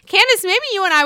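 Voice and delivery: high, loud laughing call of the name; laughing shout. Real, not acted. Host laughs and calls out to Candace, high laughing shout. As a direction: burst out laughing as you call her. Laughing outburst at cast member.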